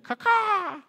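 high imitated gull caw; two-note mock bird call; seagull squawk. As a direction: screech like a seagull overhead. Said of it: "one of those little seagulls".

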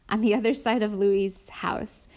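plain reading voice

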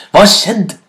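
startled shout. A narrator's reading. Hyena to its thin children, shouting in dismay. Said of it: "ropte hyenen".

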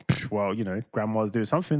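usual speaking voice

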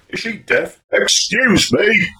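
loud, high, drawn-out furious yell; lost his temper. Retold or imitated. Driver yelling at a deaf-seeming old woman, angry shout. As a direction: bellow it slowly, fed up. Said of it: "He finally lost his temper and went".

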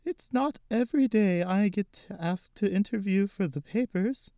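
a hint of a shaky grandmother. Gigi greets the interviewer, a touch shaky old lady. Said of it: "she said in a shaky voice".

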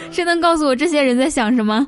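ordinary talk

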